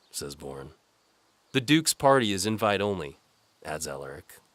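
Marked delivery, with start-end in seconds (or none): 1.5-3.2 higher, louder challenging guard; gruff guard. Acted. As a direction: bark at the unwelcome visitor. Guard refuses entry.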